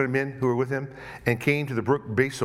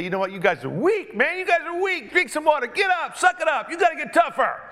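high, loud barking taunt; bully them like a harsh coach; mock drill sergeant; 12 semitones higher, 4 dB louder than usual. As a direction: leader mocking tired men, high and loud.

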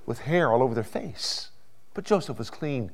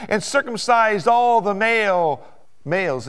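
full-force preaching; 9 semitones higher, 7 dB louder than usual. Real, not acted. Preacher telling why the brothers need harsh treatment, full-force preaching. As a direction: build to high, loud pulpit oratory.

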